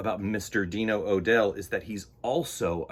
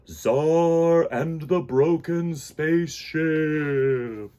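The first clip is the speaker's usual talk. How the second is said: very slow, drawn-out title announcement; grand slow title reveal; dramatic title read; 5 semitones higher, 4 dB louder, much slower than usual. Acted.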